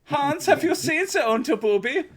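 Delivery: put-on very high-pitched comic German accent; terrible German impression. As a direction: squeal it as a suggestive mock German. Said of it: "impressions of Germans at them".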